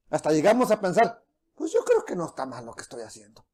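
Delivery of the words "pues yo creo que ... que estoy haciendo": mock self-justifying. Believer's self-justifying thought.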